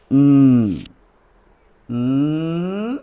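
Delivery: "উম" as a long humming nasal consonant; letter N sound. Shown as a demonstration. Teacher demonstrates the sound of N to pupils, humming "উম". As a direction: hold "উম" as a drawn-out hum for N. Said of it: "অ্যান এর sound?".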